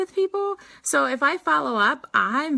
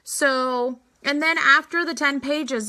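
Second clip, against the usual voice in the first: normal talking voice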